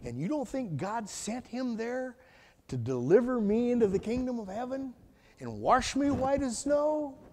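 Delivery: ordinary delivery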